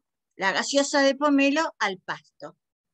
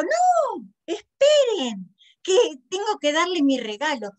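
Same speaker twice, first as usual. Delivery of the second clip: high, drawn-out call to stop; urgent interruption; 6 semitones higher, 4 dB louder than usual. A narrator's reading. Sarita stopping the cake to give her gift, high urgent call. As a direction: stretch it out high to halt everyone.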